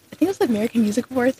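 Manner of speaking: ordinary talk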